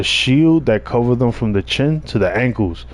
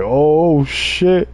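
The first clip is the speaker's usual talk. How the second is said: nervous; 6 semitones higher than usual. Retold or imitated. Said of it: "sitting there mad nervous".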